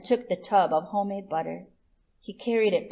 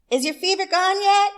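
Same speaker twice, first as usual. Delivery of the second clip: much higher and louder call; 12 semitones higher, 8 dB louder than usual. Acted. Chula Fox calling to Rabbit behind the rocks, high and loud. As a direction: shout it across the yard up high.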